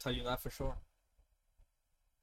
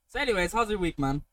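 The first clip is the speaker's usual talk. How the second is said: normal talking voice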